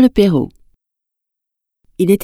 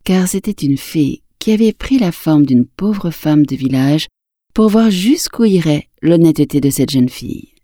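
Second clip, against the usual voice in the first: usual speaking voice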